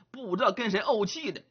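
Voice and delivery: usual speaking voice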